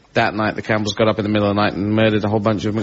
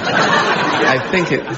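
laughing; much slower than usual. Real, not acted. Laughing mid-sentence.

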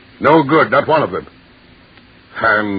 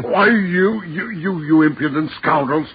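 sputtering rage; 4 semitones higher than usual. Acted. Lord sputters at intruding fishermen.

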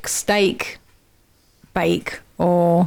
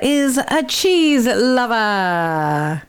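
slow reveal; 2 semitones higher, much slower than usual. Real, not acted. Dramatic reveal of the word.

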